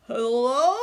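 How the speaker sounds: loud spluttered hello; sputtered hello. A narrator's reading. Grandma answering with coffee, sputtering. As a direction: sputter it over your coffee. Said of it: "sputtered Grandma".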